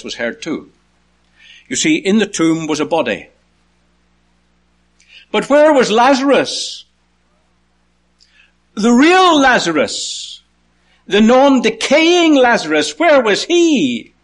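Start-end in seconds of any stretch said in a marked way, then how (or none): 5.3-14.1 high, loud, stretched-out questions; impassioned preaching. Acted. Preacher asking the congregation where Lazarus was, high and forceful. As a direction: press each question slowly at a raised pitch.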